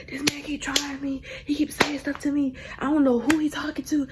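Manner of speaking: high, soft retold complaint; complaining to dad. Daughter calling her daddy about the man, high soft complaint. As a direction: plead your case in a small high voice.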